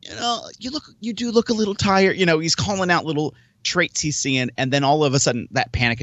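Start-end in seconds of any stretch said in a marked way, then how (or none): 0.0-2.2 tentative, higher-pitched gentle observation; polite. Retold or imitated. Podcast host gently questioning his guest, very polite. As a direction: broach it delicately, pitched up high. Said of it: "says it very politely".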